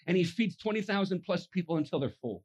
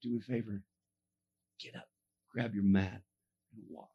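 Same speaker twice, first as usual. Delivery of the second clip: tender command; 12 semitones lower, 6 dB quieter than usual. Retold or imitated. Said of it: "looks at the man and says".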